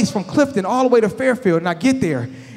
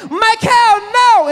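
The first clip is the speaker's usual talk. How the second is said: much higher, loud mock wail; anguished yell; 11 semitones higher, 4 dB louder, much slower than usual. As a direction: scream his name in despair. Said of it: "I heard a loud yell".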